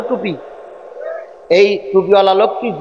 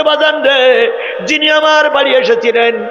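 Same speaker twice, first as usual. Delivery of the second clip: mother's fervent reveal; 9 semitones higher than usual. Acted.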